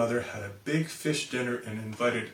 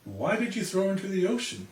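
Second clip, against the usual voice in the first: mild yell; 6 semitones higher than usual. Acted. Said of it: "The mother yelled".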